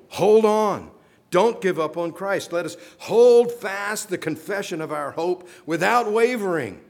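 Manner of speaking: high, loud preached appeal; preaching at full force. Real, not acted. Preacher pleading with congregation to hold on. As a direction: urge them to hold fast. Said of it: "That's his point".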